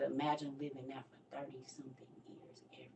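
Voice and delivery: soft, fast whisper. Retold or imitated. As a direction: breathe it out under the breath. Recalled line, breathy near-silent.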